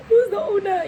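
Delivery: high, loud mock outrage; retold high-pitched indignant question; agitated outburst. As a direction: fire it back at full agitation. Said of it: "we were on a hundred".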